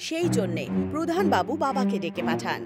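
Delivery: usual speaking voice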